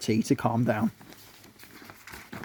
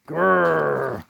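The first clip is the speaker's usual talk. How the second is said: growl. A narrator's reading. Ant growling.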